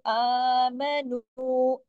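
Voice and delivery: आमनु very slow, first a held one alif long; Quranic recitation. Shown as a demonstration. Teacher recites आमनु to show the long a. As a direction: stretch the a of आमनु. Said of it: "एक अलिफ के बराबर खींचेगा".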